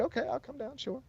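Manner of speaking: soft, high-pitched quoted yes; retold meek agreement. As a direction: imitate an obliging wife in a small, high voice. Wife agreeing to police request.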